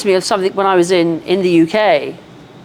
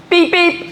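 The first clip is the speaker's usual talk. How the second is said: high mock electronic beep; imitated beeping; 10 semitones higher than usual. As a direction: chirp the beep like a machine.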